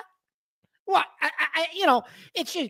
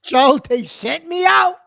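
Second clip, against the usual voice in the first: slow, louder complaint, drawn out; exasperated outburst; 2 semitones higher, 7 dB louder, slower than usual. Real, not acted. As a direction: moan it to Joe like a martyr. Griping to Joe about errands.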